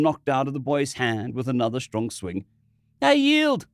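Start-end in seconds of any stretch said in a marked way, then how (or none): 3.0-3.7 high, loud frightened cry. Acted. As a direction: cry out in terror. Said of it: "the boy cried out".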